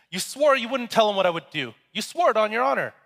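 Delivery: high, loud betrayed protest; acted shout. Betrayed scorpion to snake across the river, high acted shouting. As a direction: accuse the snake loudly across the water. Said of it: "He shouts across the water".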